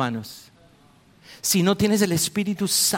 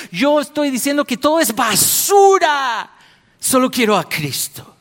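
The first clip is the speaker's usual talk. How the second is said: louder, higher proclaiming shout; Paul's declaration; 8 semitones higher, 5 dB louder than usual. Retold or imitated. Preacher voices Paul rejecting everything but Christ. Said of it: "como Pablo decía".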